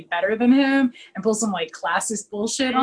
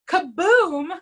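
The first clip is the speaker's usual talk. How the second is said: kaboom; 7 semitones higher, 3 dB louder than usual. Retold or imitated. Retelling the explosion.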